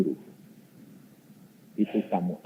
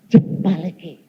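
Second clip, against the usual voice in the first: firm command; 6 semitones higher, 8 dB louder, slower than usual. Retold or imitated. God's command in retelling.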